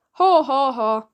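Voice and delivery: loud wooden haw haw haw; a forced laugh from a man who never smiles; stiff laugh. A narrator's reading. Unsmiling butler forcing a loud wooden laugh. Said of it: "a noise that sounded a little like a laugh".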